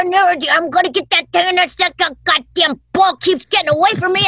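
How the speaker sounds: high, eager cartoon dog voice. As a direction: voice the dog chasing its ball. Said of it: "giving them voices".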